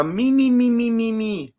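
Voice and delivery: high, drawn-out mi-mi-mi; mocking whine. Speaker mocking middle-class complaints, high whiny mimicry.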